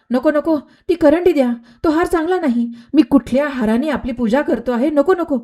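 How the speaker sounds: high, urgent begging for the basket; panicked gardener pleading to take it back; frightened pleading. Acted. Gardener begs Meera to return the basket, high and panicked.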